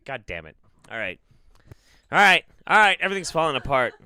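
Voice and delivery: usual speaking voice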